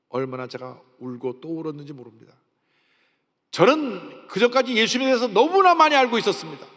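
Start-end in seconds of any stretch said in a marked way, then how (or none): 3.5-6.7 high, loud pulpit cry; impassioned preaching. Real, not acted. Preacher testifying about knowing of Jesus, impassioned and loud. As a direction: cry it out at full force, high and sustained.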